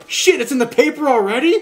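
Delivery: high, loud shocked outburst; shocked lion. Acted. The lion at the gorilla, loud shocked punchline. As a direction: blurt it out in disbelief. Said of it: "Lion goes".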